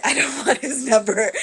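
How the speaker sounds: amused disbelief, laughing; laughing off the sister's offer; laughing refusal. Retold or imitated. Her own retort while laughing.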